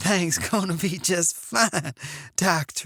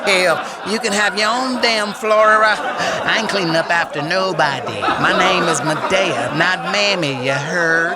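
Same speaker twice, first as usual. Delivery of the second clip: sassy grandma voice; 3 semitones higher, 7 dB louder than usual. Acted. Plays Medea character.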